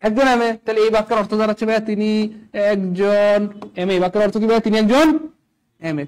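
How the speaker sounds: normal talking voice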